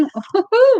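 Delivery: high playful woo-hoo; excited whoop. Real, not acted. Reader teasing client over sex card, playful whoop.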